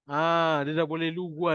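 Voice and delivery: ordinary delivery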